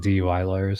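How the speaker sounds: ordinary delivery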